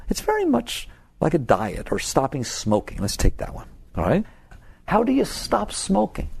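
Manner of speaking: normal talking voice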